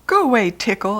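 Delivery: ordinary delivery, nothing marked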